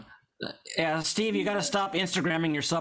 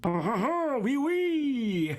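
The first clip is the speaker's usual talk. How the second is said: mock French accent, higher; 8 semitones higher, much slower than usual. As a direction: play it as a hammy Frenchman. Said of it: "pretty good French accent".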